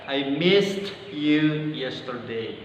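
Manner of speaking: "I missed you yesterday" spoken very slowly, word by word; slow example sentence. Shown as a demonstration. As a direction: read it out slowly as a model sentence. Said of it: "salah satu contohnya dalam kalimat". (kalimat contoh diucapkan pelan-pelan)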